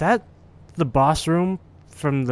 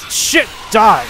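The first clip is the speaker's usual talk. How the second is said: high, loud yell; startled combat shout; 7 semitones higher, 8 dB louder than usual. Real, not acted. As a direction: yell it at an attacking enemy. Sudden fight with enemy in game.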